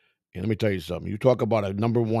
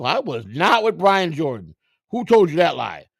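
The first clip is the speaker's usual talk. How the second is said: higher, drawn-out protest; indignant denial; 6 semitones higher, 5 dB louder, slower than usual. Retold or imitated. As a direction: act out an offended denial to the boss. Retold indignant denial to manager.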